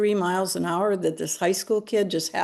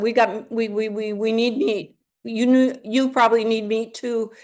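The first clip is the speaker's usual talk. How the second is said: freaking out; 3 semitones higher than usual. Retold or imitated. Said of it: "She's just freaking out".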